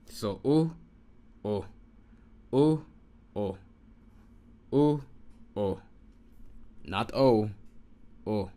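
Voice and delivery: loud, clear U and O alternated; vowel drill. Shown as a demonstration. Teacher drilling vowels to students, U loud. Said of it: "sounds like an U and this sounds like an O".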